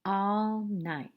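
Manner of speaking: usual speaking voice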